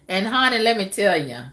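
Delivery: ordinary talk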